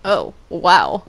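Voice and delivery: normal talking voice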